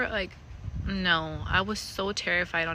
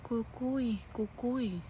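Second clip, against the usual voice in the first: high, soft little call; small, eerie child's voice, softly falling; half-asleep daughter; 4 semitones higher, 7 dB quieter, much slower than usual. Retold or imitated. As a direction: imitate a sleepy toddler, quiet and higher. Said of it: "and then she's like".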